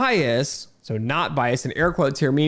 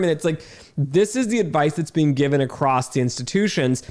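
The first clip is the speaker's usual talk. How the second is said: ordinary delivery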